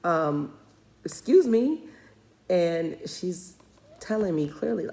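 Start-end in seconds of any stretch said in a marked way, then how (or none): 0.0-1.8 mock indignant mom. Mother retelling her reply to her five-year-old, mock indignant. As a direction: put the child in her place, half joking. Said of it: "I'm like".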